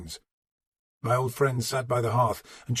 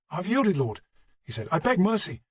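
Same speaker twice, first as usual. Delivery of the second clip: pleading; 7 semitones higher than usual. Acted. Defeated man pleading for mercy.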